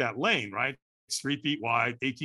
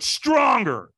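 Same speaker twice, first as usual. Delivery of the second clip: higher, louder coaching shout; trainer shouting; 9 semitones higher, 7 dB louder than usual. Retold or imitated. Trainer pushing him before the firewalk, sharp shout. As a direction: push him harder with one word. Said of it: "And he went".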